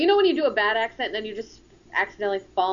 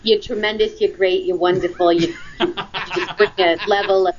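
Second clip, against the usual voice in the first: Trump impression; 7 dB louder than usual. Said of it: "I don't do a good Trump accent".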